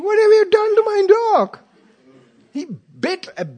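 high, loud, astonished outcry; mock shocked owner. Homeowner astonished at calm dog: high, loud outcry. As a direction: blurt it out high and loud in disbelief. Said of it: "They're going".

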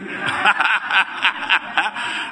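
high, airless burst of laughter; breathy laugh. Real, not acted. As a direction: laugh hard at the punchline. Laughs at the London joke.

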